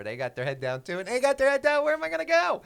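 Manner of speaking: building to a high, loud yell; exasperated rant. Retold or imitated. Speaker mimicking frustration at people walking head down, exasperated yelling. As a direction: raise your voice into an exasperated shout. Ranting frustration at phone walkers.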